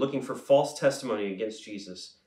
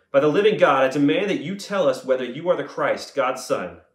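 forceful demand; 3 semitones higher, 7 dB louder than usual. A narrator's reading. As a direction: open loudly and insist on an answer. High priest demands an answer from Jesus.

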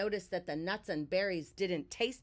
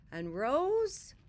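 higher and louder rising; building up higher; 7 semitones higher, 4 dB louder than usual. A narrator's reading. Narrator builds the rising feeling inside Bear, higher. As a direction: lift it as the feeling grows.

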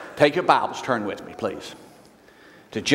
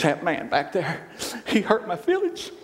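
mock whiny mother; 8 semitones higher than usual. Crying mother complains.

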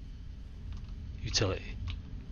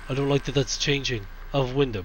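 normal talking voice

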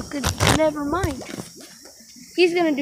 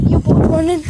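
hype shout; 8 dB louder than usual. Real, not acted. Hyping himself up running.

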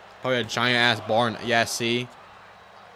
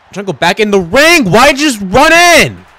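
louder and higher, yelling at the screen; frustrated yell; 8 semitones higher, 14 dB louder than usual. Real, not acted. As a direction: shout your annoyance at the AI partner. Yelling at game wrestler.

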